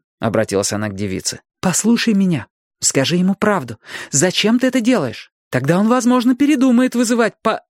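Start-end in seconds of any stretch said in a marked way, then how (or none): 1.6-7.6 persuasive woman. A narrator's reading. Robin coaxes the girl.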